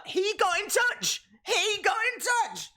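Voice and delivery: very high, excited exclamation; excited disbelief. Real, not acted. Telling viewers who got in touch, excited high exclamation. Amazed the clip's fan contacted him.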